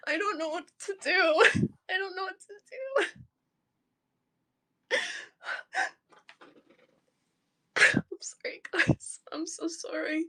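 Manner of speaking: small, sobbing voice; crying. Real, not acted. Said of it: "I'm just crying".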